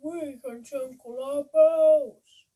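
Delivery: loud, slow mooing cow voice; the cows agree with a slow, loud moo. Acted. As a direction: stretch it out like a cow. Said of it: "mooed the cows".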